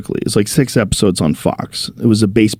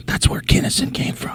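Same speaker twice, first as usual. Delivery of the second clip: imitating awestruck whisper; hushed tones; 8 dB quieter than usual. Young comics trading lore about the Comedy Store, hushed tones. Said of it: "like, with hushed tones".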